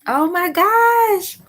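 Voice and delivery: high, loud, drawn-out exclamation; overwhelmed delight. Real, not acted. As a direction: burst out, stretching the last word. Sudden joy at own revelation.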